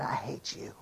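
evil, scratchy whisper, barely voiced; Satan. Satan facing the dreamer eye to eye, evil scratchy whisper. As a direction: whisper it low with quiet malice. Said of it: "evil, scratchy voice".

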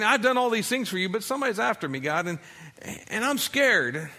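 whining that somebody's after him; scared plea to God. Acted. Elijah tells God he is scared, pleading. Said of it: "He's speaking to God, saying".

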